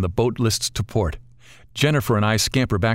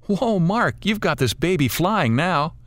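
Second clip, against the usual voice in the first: thrilled woman's whoop, much higher; excited shout; 8 semitones higher, slower than usual. Acted. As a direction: shout with delight over the wind.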